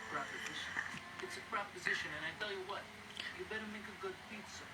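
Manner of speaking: lightly voiced Godfather threat; mock Italian mafia accent. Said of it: "speaking of the Italian accent".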